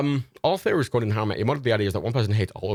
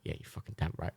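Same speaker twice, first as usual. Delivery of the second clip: smug dog whisper; 4 semitones lower, 14 dB quieter than usual. Retold or imitated. Smug dog voice, pillow won.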